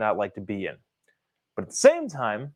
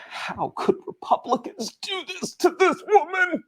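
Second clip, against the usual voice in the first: much higher, whiny mock outrage; mocking media outrage. Host lampooning how the media frames the shutdown, as whiny mock outrage. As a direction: ham up a pearl-clutching reporter. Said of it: "the media goes out of their way to say".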